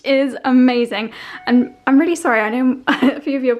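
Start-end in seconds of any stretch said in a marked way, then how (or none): none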